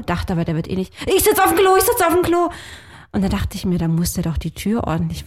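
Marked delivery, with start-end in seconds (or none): 1.1-2.5 high, loud mock yell through a door; retold shout from the toilet. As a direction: call it out like he's stuck on the loo.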